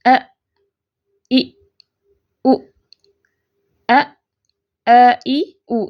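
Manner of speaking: ا، ای، او each cut off with a sharp glottal catch; hamza with a jerk. Shown as a demonstration. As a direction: clip each vowel shut in the throat. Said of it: "تھوڑا سا جھٹکے کے ساتھ پڑھا جاتا ہے".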